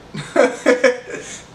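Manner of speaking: a high, helpless laugh; giggling at himself; burst of laughter. Real, not acted. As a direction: laugh at the memory. Laughs at own story.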